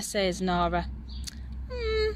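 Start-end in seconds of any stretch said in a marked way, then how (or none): 1.7-2.1 put-on high wavering old-lady voice; a gently teasing grandma; doubtful Grandma. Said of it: "replies Grandma".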